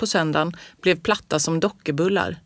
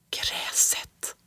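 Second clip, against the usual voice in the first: breathless secret whisper; 9 dB quieter than usual. A narrator's reading. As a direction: whisper it to yourself in awe. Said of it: "viskade jag".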